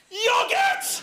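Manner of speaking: high, laughing yelp of yogurt; sudden cry. Retold or imitated. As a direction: blurt it out like a revelation. Sudden mock cry from a bench.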